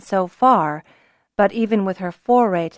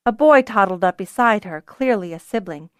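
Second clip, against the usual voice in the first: ordinary delivery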